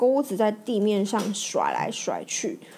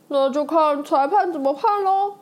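smirking, a bit higher, teasing; cheeky grin; 5 semitones higher, 3 dB louder than usual. Acted. Bob to the racers after being called a cheat, cheeky. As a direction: toss it off with a grin, unbothered. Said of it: "巴布嬉皮笑脸的说".

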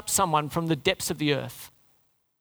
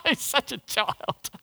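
laughing at Jonah, slow and higher; mocking laugh; 6 semitones higher, much slower than usual. Preacher laughing with the congregation at Jonah. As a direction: chuckle it out, amused at the childish prophet. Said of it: "we're all gonna have a laugh at Jonah".

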